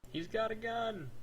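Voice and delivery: mock panicked shout. Calling out in alarm.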